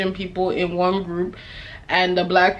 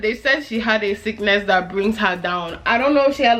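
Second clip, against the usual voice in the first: ordinary delivery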